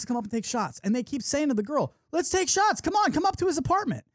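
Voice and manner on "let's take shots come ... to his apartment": high-pitched imitated pleading; eager coaxing. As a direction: egg a friend on in a higher voice. Couple coaxing the girl, retold.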